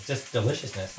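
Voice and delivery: ordinary talk, nothing marked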